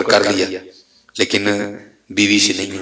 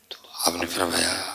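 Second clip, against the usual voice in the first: whispered aside; 3 semitones lower, 11 dB quieter than usual. A narrator's reading. Preacher telling the congregation the saint's reply, in a whisper. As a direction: whisper it, barely voiced.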